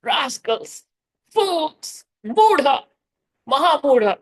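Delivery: mild mock rebuke; imitating Prabhupada's scolding. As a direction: scold like an exasperated teacher. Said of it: "he's been found saying".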